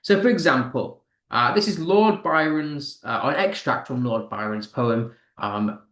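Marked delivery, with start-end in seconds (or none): none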